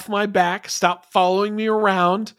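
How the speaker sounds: hammed-up kid insisting he's all grown up; mock bratty defiance. Mocking Archer as defiant kid.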